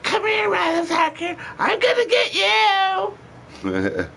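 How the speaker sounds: very high mock yell, dragged out slow; imitating shrieking threat. Partner chasing him over the snake, high slow mock shriek. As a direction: shriek the threat like a furious woman.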